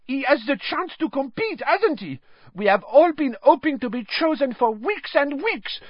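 put-on high, loud French accent, indignant; indignant Fleur. A narrator's reading. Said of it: "burst out Fleur Delacour".

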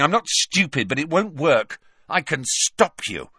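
put-on high, petulant rich boy; defiant sneering teen. A narrator's reading. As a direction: snap back, sulky and proud. Malfoy defies Snape.

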